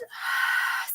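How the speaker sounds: long breathy release of air; leading viewers in a sigh; deep sigh. Shown as a demonstration. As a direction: let the breath go in a big sigh. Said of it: "sigh it out".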